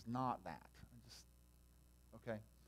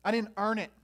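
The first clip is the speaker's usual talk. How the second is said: I didn't earn it, shouted much louder; loud protest; 7 semitones higher, 12 dB louder than usual. Shown as a demonstration. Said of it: "some of us are like that".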